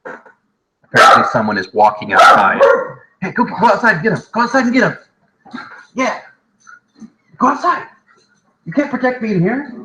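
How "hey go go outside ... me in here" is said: high, fast coaxing of the dog; pet talk. Real, not acted. Owner sending his dog outside, in a high coaxing voice. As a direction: shoo the dog out, high and playful.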